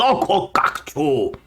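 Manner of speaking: mock goblin language; harsh nonsense syllables called to other goblins; Goblinoid jabber. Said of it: "this voice yell something in Goblinoid".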